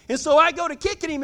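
ordinary delivery

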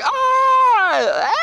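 put-on high, drawn-out kid's wail; mock alarmed yell. Kid on a trotting horse, mock alarmed yell. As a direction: imitate a kid jolted into a trot.

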